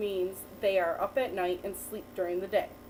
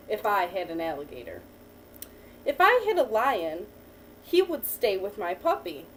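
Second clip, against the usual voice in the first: put-on reading to a child. Teacher reading a picture book to a child, lively storytelling. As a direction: read the picture book to the child, lifting each new animal.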